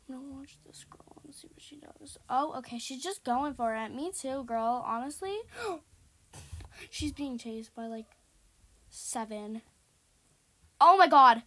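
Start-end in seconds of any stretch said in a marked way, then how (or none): 0.1-2.2 soft, cautious near-whisper; hushed whisper. Real, not acted. Gamer watching a girl play first, hushed whisper. 10.8-11.4 very loud burst of alarm; startled shout. Real, not acted. Gamer running into three in a corner, startled shout. Player caught by heads.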